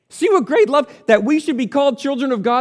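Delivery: rising wonder at God's love; exclaiming. Shown as a demonstration. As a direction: proclaim it in awe. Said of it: "That's, that's what he said".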